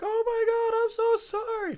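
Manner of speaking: high-pitched mock apology; panicked lady driver. Lady driver to the rider she hit, high panicked apology. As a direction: imitate her in a very high, frantic voice.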